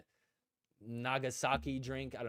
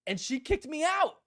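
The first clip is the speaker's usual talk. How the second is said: kicked-me-out line pitched way up with a rising end; indignant punchline; 13 semitones higher, 6 dB louder than usual. Real, not acted. Telling viewers how Leia threw him out, pitched high in mock outrage. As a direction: land it high, half outraged and half amused.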